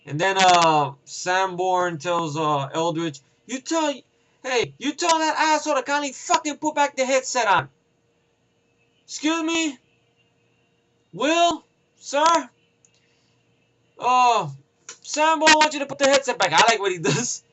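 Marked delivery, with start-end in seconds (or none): 3.5-7.7 higher mock soldier voice, annoyed; mimicking Sanborn. Sanborn relaying an order to Eldridge, mock annoyed higher voice. Sanborn angry at Renner's character. 9.0-16.5 high, hesitant mock voice; timid Eldridge. Eldridge nervously passing the order to Will, high timid voice.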